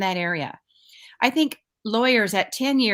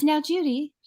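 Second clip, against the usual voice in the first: a light, higher-pitched imitation of an English accent; 7 semitones higher than usual. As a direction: mimic her English colleague's accent, playfully. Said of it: "this is my best accent".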